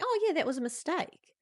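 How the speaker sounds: high, light and forgiving; breezy mum. Retold or imitated. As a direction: wave it off like a relaxed mother. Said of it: "she's already being like".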